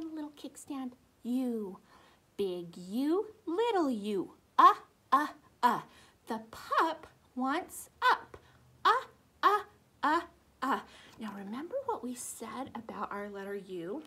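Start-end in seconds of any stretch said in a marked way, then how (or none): none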